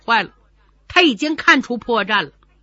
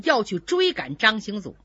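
ordinary delivery, nothing marked

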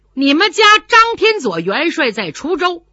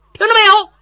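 loud challenge; 10 semitones higher, 8 dB louder, much faster than usual. Acted. Warrior presses the point.

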